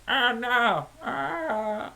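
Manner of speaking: high squealing oh no, then a crying ah; panicked apple snake. Acted. Game master voicing the apple snake hit by moonbeam, high panicked squeal. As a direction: squeal like a terrified cartoon monster. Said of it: "the giant apple snake goes".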